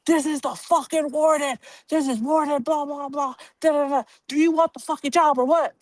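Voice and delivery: hammed-up angry warden on the phone; impatient cursing warden. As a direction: play the boss barking impatient orders.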